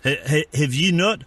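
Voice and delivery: lightly put-on South African accent, deadpan; mock South African accent. Prank caller playing neighbourhood watch, mock South African accent. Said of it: "It was South African".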